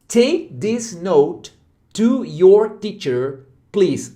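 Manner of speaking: slow, careful English request; slow model sentence. Shown as a demonstration. Teacher models the request slowly for students. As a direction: read it out slowly and clearly. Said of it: "Outro exemplo, olha só".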